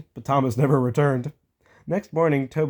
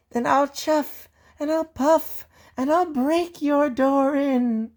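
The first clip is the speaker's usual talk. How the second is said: high, slow menacing threat; big bad ghost; 13 semitones higher, slower than usual. Percy threatening to break the door in, menacing. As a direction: huff like the wolf at the door.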